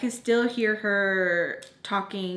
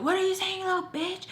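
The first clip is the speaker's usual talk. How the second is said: retold scream; 9 semitones higher than usual. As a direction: imitate a mom screaming a challenge. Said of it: "she's screaming and she's like".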